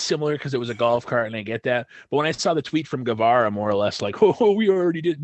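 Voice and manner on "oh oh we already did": high, loud mock boasting; sneering parody of someone's claim; mocking imitation. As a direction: mimic a whiner, pitched up and louder. Mocking a rival's tweet.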